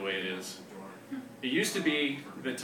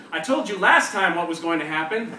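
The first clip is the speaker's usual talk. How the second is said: louder, higher scolding neighbor; shrill voice; 6 semitones higher, 10 dB louder than usual. Retold or imitated. Neighbor shouts from a window at the walker, higher and louder. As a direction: call down sharply from a window. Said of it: "a shrill voice saying".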